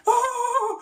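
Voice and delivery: high cartoon titter; Shaggy nervous laugh. Shown as a demonstration. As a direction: laugh scared through the nose and breath.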